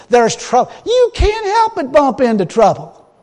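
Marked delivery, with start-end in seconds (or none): none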